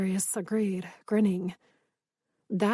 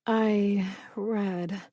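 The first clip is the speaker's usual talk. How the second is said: slow and hoarse. A narrator's reading. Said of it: "I said slowly, my voice hoarse".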